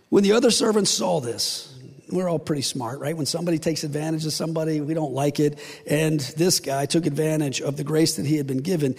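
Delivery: ordinary delivery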